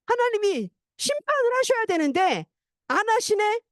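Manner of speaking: indignant grumble. Retold or imitated. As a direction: voice Jonah's sulking outrage. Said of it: "너무 화가 나는 거예요".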